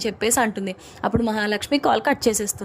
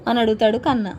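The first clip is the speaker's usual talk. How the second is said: usual speaking voice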